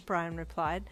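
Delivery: ordinary delivery, nothing marked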